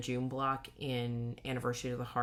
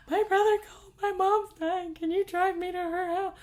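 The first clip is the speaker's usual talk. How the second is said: panicked plea; 7 dB louder than usual. Retold or imitated. Imagined panicked plea to cop.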